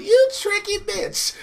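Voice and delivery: high mock-outraged screech; outwitted villain squeal. Queen of Fables realizes she's tricked, high indignant squeal.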